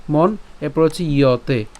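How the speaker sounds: ordinary talk, nothing marked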